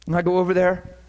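ordinary talk, nothing marked

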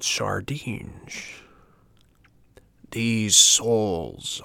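slow, relishing, half-whispered gloating; chuckling to himself. A narrator's reading. Fisherman gloating over his catch, slow and relishing. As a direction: savour every fish name greedily. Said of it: "chuckling to himself".

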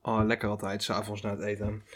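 quiet, just after a sip of coffee; savoring a sip of coffee, softly; while sipping a drink. Real, not acted. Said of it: "Ondertussen neem ik even een slokje koffie". (na een slokje koffie, zacht genietend)

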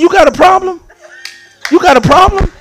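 put-on high-pitched challenge to his young son; indignant retold dad; serious confrontation. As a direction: square up to a child in mock earnest. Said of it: "And I was serious".

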